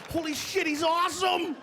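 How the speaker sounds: mock awestruck kids; amazed kids. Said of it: "They're just sitting there".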